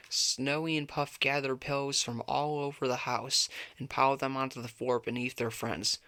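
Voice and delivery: ordinary delivery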